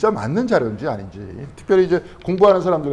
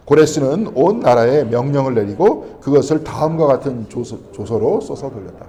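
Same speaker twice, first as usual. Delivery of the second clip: loud, slow, measured Bible reading; scripture reading; 5 dB louder, slower than usual. Real, not acted. Preacher reads the verse aloud to the congregation, loud and slow. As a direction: read the verse out loud and slowly.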